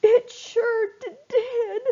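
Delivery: high, tearful stammer; crying and stuttering. Acted. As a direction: sob out the broken words. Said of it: "Big tears started running".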